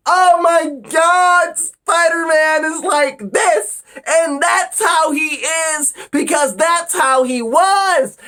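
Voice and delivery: very high, loud whining fan voice; mocking whiny fan. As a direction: shriek like an obsessed fan. Mocking fans' fixation.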